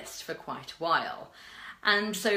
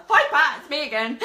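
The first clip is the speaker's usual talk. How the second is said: high, loud, laughing singsong greeting; mock pestering fan; 9 semitones higher, 11 dB louder than usual. Imagined stalker following Pat around the conference, mock-eager. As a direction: call out over-keenly, breaking into laughter.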